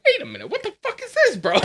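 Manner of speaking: very high, squeaky and laughing; high laughing what-is-this; shocked disbelief. Retold or imitated. Retold shock seeing maskless diners.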